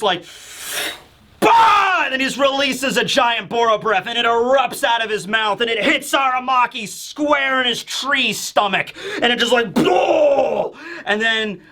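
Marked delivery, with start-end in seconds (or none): 1.4-2.0 high loud burst "bah"; sudden high loud fire-breath roar; dragon blast roar. Retold or imitated. Momonosuke finally unleashes breath. 9.7-10.7 impact blast sound. Retold or imitated. Breath hits Aramaki.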